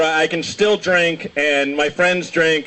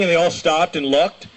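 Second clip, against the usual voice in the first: usual speaking voice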